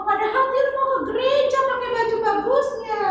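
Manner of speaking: put-on high, sing-song little girl voice; chirpy kid puppet answering the pastor; high child puppet voice. Tiur tells her own story.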